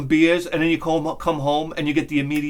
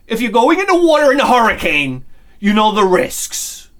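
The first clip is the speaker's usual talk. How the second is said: emphatic warning; 6 semitones higher, 7 dB louder than usual. Real, not acted. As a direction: lay down the law loudly. Lecturing on surfers' unwritten rule.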